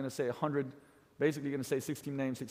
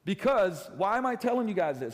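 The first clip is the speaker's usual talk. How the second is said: ordinary talk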